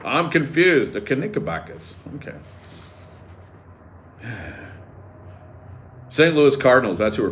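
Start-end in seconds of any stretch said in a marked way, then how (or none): none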